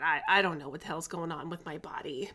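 normal talking voice

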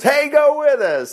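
high, slow friendly invite. Retold or imitated. Moses inviting his brother-in-law along, high friendly call.

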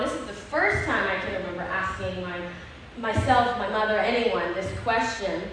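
ordinary talk

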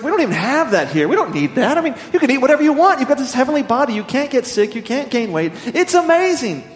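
high, loud, fast gushing Saint Peter; exasperated excitement. Acted. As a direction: burst out that there are no limits here.

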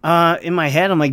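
ordinary talk

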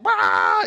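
loud wordless road-rage "Ah!"; hammed-up holler of frustration; exasperated yell. Driver cut off on the freeway, exasperated yell.

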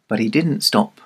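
ordinary delivery, nothing marked

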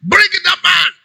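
high-pitched, ringing order; acted imperious call; commanding. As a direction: call out a command with full authority. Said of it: "Jesus commanded".

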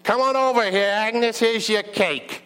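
high New York holler, drawn out; New York accent. Acted. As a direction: call her over in a New York accent. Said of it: "have a New York accent".

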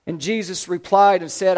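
normal talking voice

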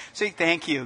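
ordinary talk

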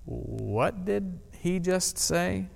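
hushed, slow, baffled mutter; half-whispered disbelief among the mourners; puzzled murmur. Retold or imitated. Mourners at the tomb muttering to each other, hushed and baffled. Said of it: "look back and forth at each other like".

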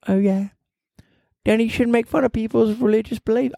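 high, prim scolding voice; scolding alter ego. Acted. Alter ego scolding the host, high and prim. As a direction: chide him in a pinched high voice.

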